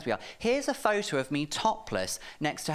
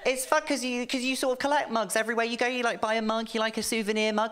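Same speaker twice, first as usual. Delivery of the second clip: put-on high, quick eager boyfriend; hopeful boyfriend explaining the mug; 8 semitones higher than usual.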